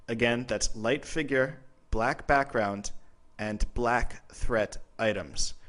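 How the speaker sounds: slow and measured, with pauses between phrases; drawn-out explanatory emphasis; slow, deliberate recap. Real, not acted. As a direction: spell it out slowly, phrase by phrase. Recaps key visual point slowly.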